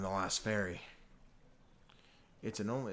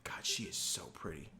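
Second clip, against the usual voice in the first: low, whispered admiration; hushed smitten aside; 6 semitones lower, 7 dB quieter than usual. Acted. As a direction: whisper it to yourself. Character's smitten aside to himself.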